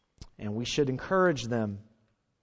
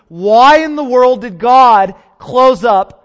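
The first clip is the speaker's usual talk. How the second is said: loud, slow, high pulpit cry; preacher pressing the central question, loud and slow; preaching at full force; 9 semitones higher, 18 dB louder, much slower than usual. Acted. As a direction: drag out the climactic question loudly. Climactic sermon question.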